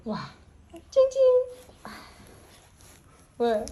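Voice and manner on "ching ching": very high, loud squeaky play sound; loud little high-pitched ding; high playful chime. Pretend. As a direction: chime it high to amuse a baby. Playful sounds to amuse baby.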